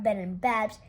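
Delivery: usual speaking voice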